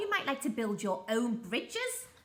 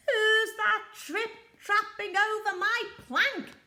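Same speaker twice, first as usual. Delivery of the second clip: high, loud mocking pirate voice; jeering; 11 semitones higher, 7 dB louder than usual. Pirate captain jeering at the troll on the plank, mocking and loud. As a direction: jeer at the troll in a sneer. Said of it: "jeered Handkerchief".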